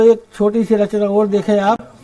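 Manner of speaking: normal talking voice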